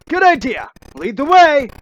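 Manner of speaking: high, loud, eager agreement; thrilled to follow the hero; excited. Acted. Fan eager to follow Sonic.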